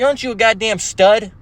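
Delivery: usual speaking voice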